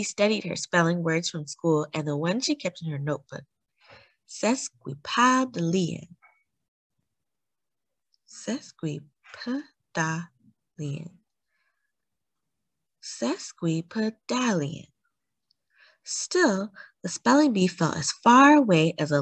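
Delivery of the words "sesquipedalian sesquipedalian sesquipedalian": slow practice. A narrator's reading. Practising a spelling word.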